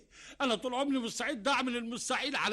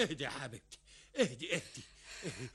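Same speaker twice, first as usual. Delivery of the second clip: soothing; 7 semitones lower, 7 dB quieter than usual. Acted. Husband soothing crying wife.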